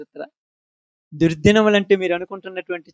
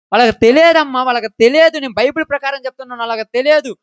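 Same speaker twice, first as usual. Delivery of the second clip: preaching at full force; 6 semitones higher, 6 dB louder than usual. Real, not acted. Preacher proclaims to the congregation.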